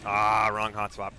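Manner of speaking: usual speaking voice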